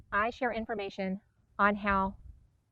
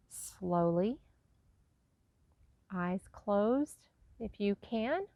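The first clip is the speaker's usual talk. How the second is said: guided meditation; 5 dB quieter than usual. Acted. Teacher guiding listeners to breathe love in and out, soft guided meditation. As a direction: ease them into each breath, softly and unhurriedly.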